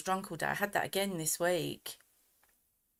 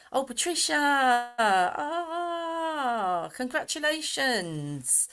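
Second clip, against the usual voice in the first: high, loud, drawn-out gush of joy; delighted congratulations; 7 semitones higher, 6 dB louder, much slower than usual. Real, not acted. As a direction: gush over someone's lovely news, stretching it out. Delighted at viewer's granny news.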